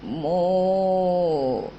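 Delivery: low drawn-out mooing; teacher shows the class a cow's low moo. Shown as a demonstration. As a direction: moo deep and long like a cow. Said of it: "how did make a noise".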